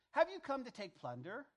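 lifted, emphatic questioning; challenging question. Acted. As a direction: call it out as an incredulous challenge. Acting out scripture's challenge question.

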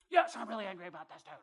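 fast, loud, high outburst; angry retort. Retold or imitated. Preacher answering the Lord about the gym guy, angry outburst. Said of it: "are you really angry about this dude".

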